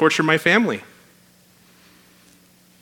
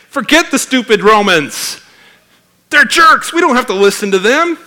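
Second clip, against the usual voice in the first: higher, louder sneering Pharisee voice; mock Pharisees; 10 semitones higher, 8 dB louder than usual. Mocking Pharisees' words.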